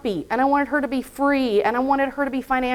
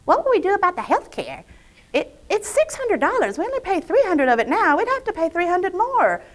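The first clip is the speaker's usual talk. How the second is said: mock-fretful, high and wavering in pitch; worried husband; 5 semitones higher than usual. Husband worrying about health care costs, mock fretting. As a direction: imitate a risk-averse spouse fussing over money. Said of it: "he kept saying".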